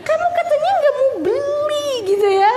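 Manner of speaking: high-pitched cross mother act; mock annoyed scolding. Mother reminds crying child of the promise, mock annoyed. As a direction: play up the exasperation, pitched high. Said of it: "kan kesel kita".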